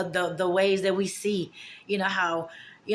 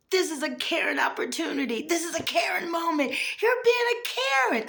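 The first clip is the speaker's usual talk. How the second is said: crowing over finally meeting a Karen; gleeful Karen taunt; 10 semitones higher than usual. Retold or imitated. Retold gleeful taunt at shopper.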